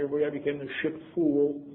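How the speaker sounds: ordinary delivery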